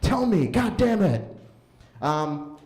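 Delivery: ordinary talk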